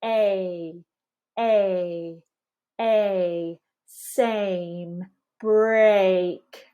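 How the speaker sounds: "A" gliding from eh up to ee; slow demonstrative glide on "A"; full diphthong glide. Shown as a demonstration. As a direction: draw out the ay in "same" and "break". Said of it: "Notice how my jaw moves up".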